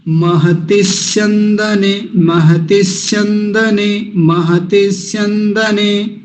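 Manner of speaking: महति स्यंदने run together, slowly; joined together. Shown as a demonstration. Teacher showing how to link महति स्यंदने. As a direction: glue स्यंदने onto महति without a break. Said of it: "देखिए मैं कैसे बोलता हूँ".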